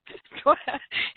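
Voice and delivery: high breathy burst of laughter; a real laugh bubbling out. Real, not acted. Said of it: "I have to laugh".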